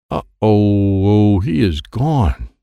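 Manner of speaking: slow, drawn-out worried uh-oh; dismayed uh-oh. Acted. Child seeing Master G vanish, slow dismay. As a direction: let the worry sink in slowly.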